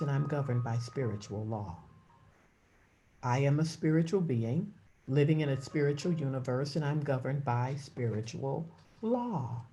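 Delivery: soft, low meditative affirmation; hushed devotional affirmation; prayerful affirmation. Acted. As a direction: speak it inward like a prayer.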